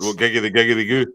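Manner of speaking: ordinary delivery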